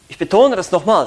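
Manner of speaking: normal talking voice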